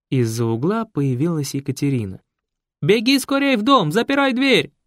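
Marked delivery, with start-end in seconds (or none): 2.8-4.7 loud, high yelling command. Acted. Raisa yells at Katya to run inside, loud. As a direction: bellow the warning. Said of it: "заорала Раиса".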